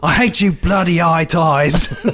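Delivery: put-on high, rowdy English football-lad accent, laughing through it; English hooligan. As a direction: bark it like a drunk English football fan. Soccer hooligan voiced in retelling.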